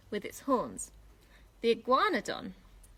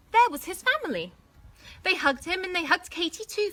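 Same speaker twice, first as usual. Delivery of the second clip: joyful; 7 semitones higher, 4 dB louder than usual. A narrator's reading. Joyful family reunion.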